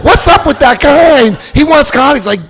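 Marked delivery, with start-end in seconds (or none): 0.0-1.3 much higher, louder baffled captain; puzzled captain. Retold or imitated. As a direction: call it over the shoulder, baffled. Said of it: "the captain's turning around going".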